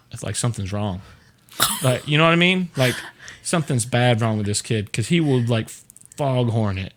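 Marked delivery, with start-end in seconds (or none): none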